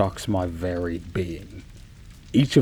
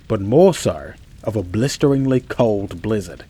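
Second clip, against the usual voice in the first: usual speaking voice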